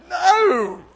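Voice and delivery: panicked refusal. Retold or imitated. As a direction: blurt "No." like a startled refusal. Refusing God's call in story.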